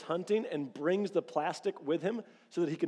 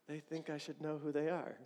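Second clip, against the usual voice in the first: much softer, under the breath; inner thought; 8 dB quieter than usual. Retold or imitated. Caller on the phone, you thinking to yourself, hushed. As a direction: mutter it to yourself, puzzled. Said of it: "and you're like".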